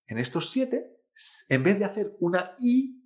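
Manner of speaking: ordinary talk, nothing marked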